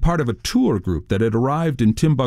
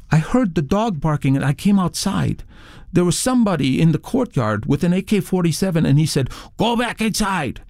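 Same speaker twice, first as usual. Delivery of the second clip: higher-pitched character voice for a witness; hotel receptionist; 7 semitones higher than usual. A narrator's reading. Receptionist telling the author about the armed intruder, high character voice. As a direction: voice the receptionist's account in a raised pitch.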